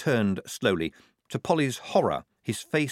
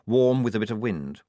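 plain reading voice

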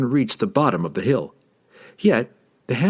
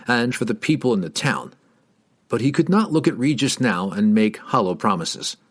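plain reading voice

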